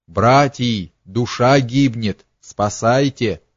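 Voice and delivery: ordinary delivery, nothing marked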